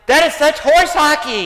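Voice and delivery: high, loud scoffing protest; re-enacted outraged yell; indignant outburst. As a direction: throw it out in exasperation. Acted outraged rejection of that attitude.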